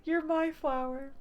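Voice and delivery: high, slow doting coo; swooning. Real, not acted. Player cooing at the character who gave a flower. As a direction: coo it, completely smitten.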